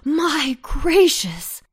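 high, loud, drawn-out exclamation; exclaiming. A narrator's reading. Stout cabbage reacts after the chase, exclaiming. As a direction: stretch it out in loud amazement.

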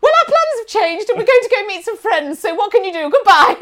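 high, loud, airy goodbye; hammed-up carefree farewell; mock breezy brush-off. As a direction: dismiss them with fake cheer. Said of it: "Like this".

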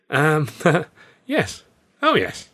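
nervous giggly yes; nervous laugh. A narrator's reading. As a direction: laugh off the awkward question.

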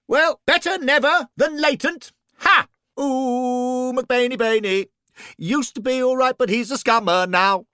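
high, gleeful teasing voice; teasing character voice. Acted. Ghost teasing McBain about Luton, high and gleeful. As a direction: crow over the pun and tease.

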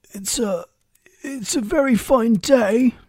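high, slow, hesitant rabbit voice; falter, then pipe up nervously; timid. A narrator's reading. Rabbit makes small talk with Alice, timid. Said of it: "said a timid voice".

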